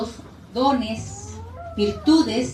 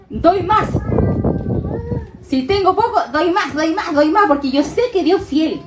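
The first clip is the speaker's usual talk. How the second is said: louder, stressing "más" each time; emphatic giving; 4 semitones higher, 3 dB louder than usual. Acted. Preacher urging the church to give more, emphatic. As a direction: hammer home "doy más".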